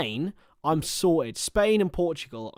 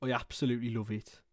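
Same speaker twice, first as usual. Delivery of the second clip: downbeat, depressed-sounding Brummie; Brummie accent; 3 semitones lower, 4 dB quieter than usual. Shown as a demonstration. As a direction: put on a glum Birmingham accent. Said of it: "sound like you're depressed".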